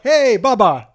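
mock-hearty, high-pitched, loud greeting; friendly greeting, imitated. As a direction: hail him like an old pal, playing the driver. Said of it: "He's being friendly".

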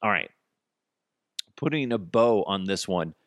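ordinary delivery

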